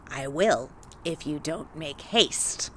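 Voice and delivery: low, slow threatening hag; old witch voice. Acted. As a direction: menace it slowly like a witch.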